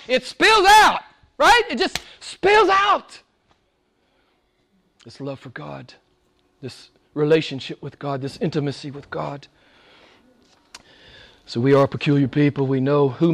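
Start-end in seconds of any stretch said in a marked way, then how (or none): 0.1-3.2 preacher's high exultant refrain; soaring emphasis. Acted. Climactic emphasis. 5.0-9.5 much softer, intimate; tender hush after the climax; hushed tenderness. Acted.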